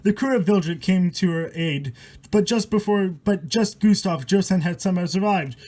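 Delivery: steady rhythmic verse read aloud; poem reading. A narrator's reading. Reading a rhyming horror poem to viewers, rhythmic storytelling.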